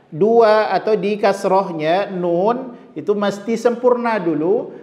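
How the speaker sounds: usual speaking voice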